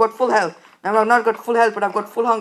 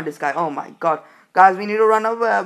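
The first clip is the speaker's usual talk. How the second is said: usual speaking voice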